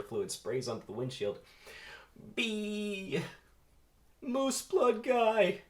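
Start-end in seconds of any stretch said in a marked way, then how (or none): 2.3-5.6 very high, slow drawn-out cry; wailing call. Acted. Barry crying for the mosquito while flung, high and slow. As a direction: wail the name as you fall.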